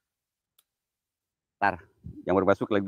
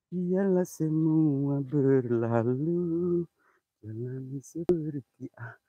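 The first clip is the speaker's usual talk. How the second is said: slow, drawn-out eerie chant, higher; spooky intoning; 3 semitones higher, much slower than usual. Acted. To the viewers at the well, a slow eerie ghostly chant.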